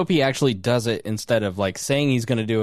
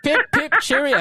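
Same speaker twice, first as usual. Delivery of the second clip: high, hammed-up British cheerio; a silly cartoon Brit, much higher; mock British accent; 14 semitones higher, 3 dB louder than usual.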